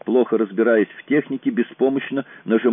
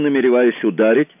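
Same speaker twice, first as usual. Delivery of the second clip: ordinary delivery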